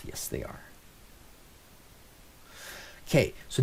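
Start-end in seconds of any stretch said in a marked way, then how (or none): 0.1-0.6 unvoiced whisper to himself; whispered aside. Real, not acted. Lecturer confirming his own question, whispered aside. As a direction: breathe the answer, barely there.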